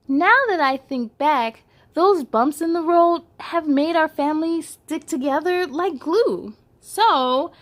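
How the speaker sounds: bright read-aloud storytelling; picture book read to little kids; story narration. A narrator's reading. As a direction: read it to children, lively and clear.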